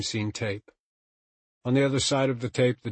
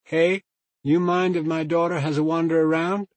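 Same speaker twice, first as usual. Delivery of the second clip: higher and louder, calling across the store; raised voice; 7 semitones higher, 6 dB louder than usual. A narrator's reading. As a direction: call out over the shop noise. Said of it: "raised her voice to be heard".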